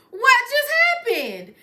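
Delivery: high, loud burst of bewilderment; loud disbelief. Retold or imitated. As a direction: burst out high, then drop on the last word. Retold disbelief, said second time.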